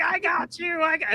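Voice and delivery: high-pitched, hammed-up yell of triumph; a playful high mock shriek; mock scream. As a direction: squeal it up high like a gloating villain. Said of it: "he's screaming, he's like".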